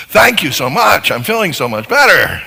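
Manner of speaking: a bright, loud show of overdone thanks to the spouse; mock grateful spouse. Spouse mockingly thanks partner after being used, loud and gushing. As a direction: gush your thanks, hamming it up.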